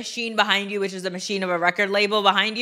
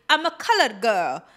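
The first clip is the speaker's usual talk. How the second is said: usual speaking voice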